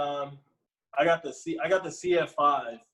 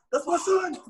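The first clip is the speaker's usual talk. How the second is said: high-pitched proud dad cheer; going ballistic; 3 dB louder than usual. Pretend. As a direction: pretend to be a dad losing it. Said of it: "I'm just going to go ballistic".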